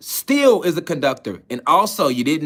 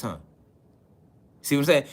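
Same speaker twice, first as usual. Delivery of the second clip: ordinary talk, nothing marked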